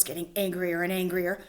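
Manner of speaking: usual speaking voice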